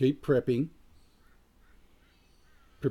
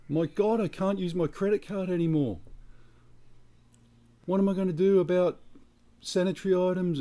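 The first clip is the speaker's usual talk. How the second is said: higher, mock fretting spouse; panicking spouse; 7 semitones higher than usual. Spouse fretting about credit cards, mock-panicked higher voice. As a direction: camp up the spouse's worried whining. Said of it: "they morph into a".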